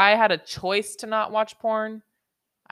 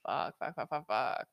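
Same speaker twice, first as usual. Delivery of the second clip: frustrated muttering; 3 semitones lower, 8 dB quieter than usual. Real, not acted.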